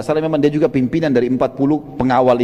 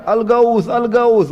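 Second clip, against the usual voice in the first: higher pitched mock yell for help; hammed-up alarm call, pitched high; retold cry of alarm; 6 semitones higher, much slower than usual. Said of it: "dia terus teriak".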